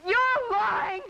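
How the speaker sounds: angry accusation. Acted. Wife accuses friend in a quarrel.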